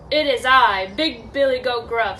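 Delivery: loud, firm announcement; confident big-goat voice; big bold goat. Acted. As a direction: declare your name fearlessly, no wavering. Big goat answers troll boldly.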